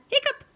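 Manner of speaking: high loud hiccup; squeaky hiccup demo. Shown as a demonstration. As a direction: show the kids a squeaky hiccup. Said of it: "Can you say that?".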